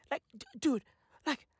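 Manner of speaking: usual speaking voice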